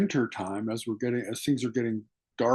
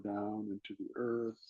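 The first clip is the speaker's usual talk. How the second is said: guided meditation. Acted. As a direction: draw them inward with hushed, patient instruction. Leads breathing meditation.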